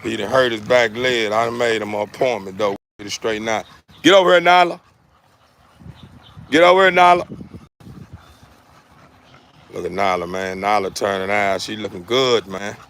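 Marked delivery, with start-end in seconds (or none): 4.0-7.4 loud, higher call across the yard; calling the dog. Real, not acted. Owner calling his dog Nyla to come over, loud high call. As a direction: call her over firmly.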